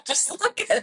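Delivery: high exasperated plea; fed up, pleading. Retold or imitated. Exasperated at husband.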